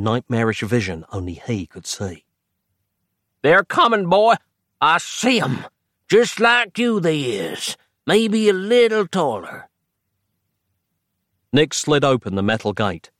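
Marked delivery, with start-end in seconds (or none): 3.4-9.7 put-on old man voice. A narrator's reading. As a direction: play a rambling old timer. Old man sees a vision.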